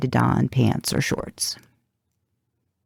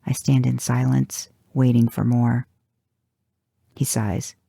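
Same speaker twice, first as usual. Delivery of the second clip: ordinary delivery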